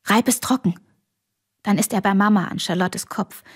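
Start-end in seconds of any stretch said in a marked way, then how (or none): 0.0-0.7 befehlend. A narrator's reading. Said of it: "und befiehlt".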